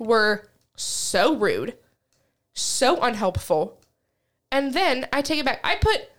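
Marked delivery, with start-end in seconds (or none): 0.0-3.8 slow, drawn-out SO before "rude" and "unhelpful"; emphatic complaint. Real, not acted. Customer complaining about rude U-Haul staff, slow and emphatic. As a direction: stretch each "so" with a pause between the complaints.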